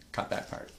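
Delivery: quick whispered note to self; whispered aside. Real, not acted. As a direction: whisper cut that part.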